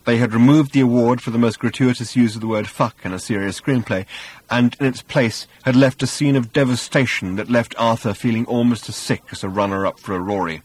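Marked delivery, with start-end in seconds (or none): none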